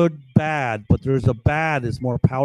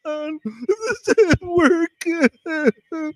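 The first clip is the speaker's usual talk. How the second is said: much higher, wailing woman's voice; hammed-up sobbing woman; mock crying woman; 14 semitones higher, much slower than usual. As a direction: blubber it like a frantic woman. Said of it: "And she starts crying".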